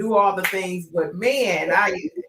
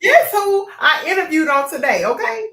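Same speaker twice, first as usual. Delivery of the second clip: excited phone call; 8 semitones higher, 5 dB louder than usual. Retold or imitated. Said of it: "I'm finna call my grandfather and say".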